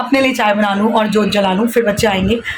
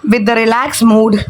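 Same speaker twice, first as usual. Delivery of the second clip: slow relaxed drawl; much slower than usual. Pretend. Announces Maggi time.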